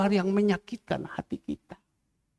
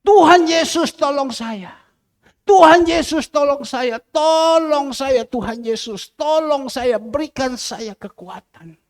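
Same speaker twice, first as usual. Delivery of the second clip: loud, high opening calls falling into pleas; fervent re-enacted prayer cry; crying out to God; 4 semitones higher, 4 dB louder than usual. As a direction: call on God fervently, stretching the cry for help. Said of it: "Barang siapa berseru".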